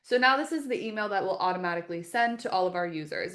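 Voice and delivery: ordinary talk, nothing marked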